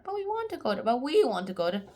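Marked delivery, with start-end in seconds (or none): 0.0-0.8 put-on squeaky high childish whine; high whiny kid. Kids begging their parents to visit the house, high whine.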